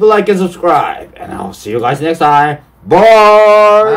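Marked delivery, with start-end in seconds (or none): 2.9-3.9 loud, drawn-out held goodbye; sign-off call. Real, not acted. Host signing off after the video, loud farewell. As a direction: call it out long to the viewers.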